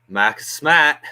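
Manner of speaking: put-on playful Boston accent on the name; mock Boston accent. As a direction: drop the r's, Boston style, half in jest. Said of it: "I don't really know how to do a Boston accent".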